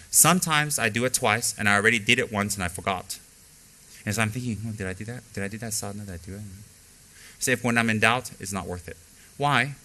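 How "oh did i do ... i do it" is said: doubting questions, much softer and fading; quietly retold worry, dying away; anxious muttering. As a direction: fret to yourself, softer and softer.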